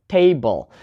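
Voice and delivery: table with a strong TA and falling BLE; stress on first syllable. Shown as a demonstration. Teacher repeating the noun example, table stressed on TA. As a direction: punch the TA in table. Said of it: "put the stress on the first syllable".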